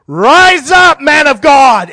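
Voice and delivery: yelling and screaming prophetic command, high and very loud; full-force shout, voice thrown high like a fiery prophet; صراخ مدوٍّ. Retold or imitated. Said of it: "he's yelling and screaming".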